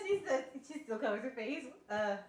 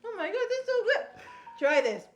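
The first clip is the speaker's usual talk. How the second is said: excited; 3 semitones higher, 10 dB louder than usual. Real, not acted. Wife raving about the sandwich.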